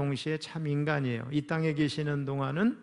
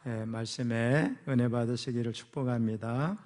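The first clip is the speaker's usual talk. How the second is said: usual speaking voice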